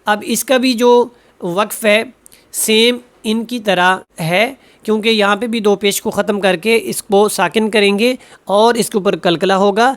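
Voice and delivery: normal talking voice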